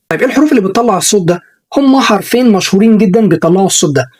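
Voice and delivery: usual speaking voice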